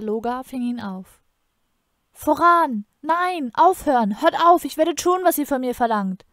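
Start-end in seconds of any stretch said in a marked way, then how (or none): 2.1-6.2 high, loud anguished shouting; frantic cries to save a loved one; desperate scream. A narrator's reading. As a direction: shout and plead for it to stop. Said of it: "schrie Galat".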